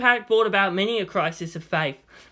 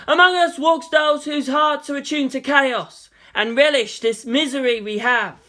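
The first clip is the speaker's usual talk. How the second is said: preaching; 6 semitones higher, 4 dB louder than usual. Acted. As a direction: preach with grave conviction. Makoto preaches to crowd.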